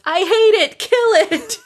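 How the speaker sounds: high, loud mock yell with a laugh behind it; yelling teacher. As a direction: imitate a panicked, hateful yell at full pitch. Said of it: "one of the teachers yells".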